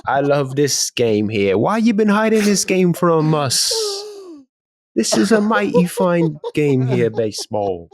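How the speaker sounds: hammed-up, wobbly London-Jamaican accent; Jamaican British accent. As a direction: persist with a terrible British accent. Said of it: "Jamaican British accent".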